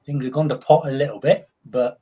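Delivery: put-on Cockney, chatty and easy; Cockney shop owner answering a mate; mock Cockney accent. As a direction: play a London shopkeeper in Cockney. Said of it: "a London accent or a Cockney accent".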